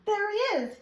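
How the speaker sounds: high, bright reveal; there he is, spotted him!; delighted find. Real, not acted. Finding the hidden owl toy.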